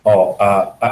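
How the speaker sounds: ordinary talk, nothing marked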